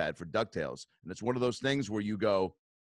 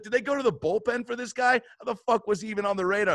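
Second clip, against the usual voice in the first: high-pitched retold indignation; acted incredulous protest; livid disbelief; 8 semitones higher, faster than usual. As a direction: question it in outraged disbelief. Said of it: "man, I was livid".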